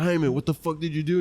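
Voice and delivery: retold angry father grilling his son; imitating angry dad. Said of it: "super angry".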